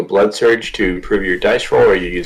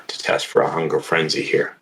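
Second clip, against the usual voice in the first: ordinary talk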